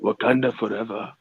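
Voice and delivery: low, deep solemn king's salute. Pretend. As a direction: drop the voice low and regal.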